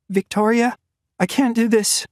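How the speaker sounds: refuse, at the end of her strength; exhausted young woman near her limit; Natalia, young woman. A narrator's reading. Natalia calling Victoria.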